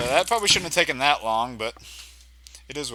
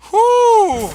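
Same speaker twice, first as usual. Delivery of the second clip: loud whew of relief; 12 dB louder than usual. Real, not acted. Relief after boss fight.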